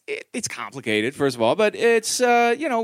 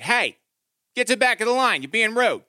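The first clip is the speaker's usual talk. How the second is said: put-on a little girl scolding, pitched up; bossy kid voice; 5 semitones higher, 4 dB louder than usual. Daughter scolding kids who cut the swing line, bossy. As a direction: tell them off like a tough small kid.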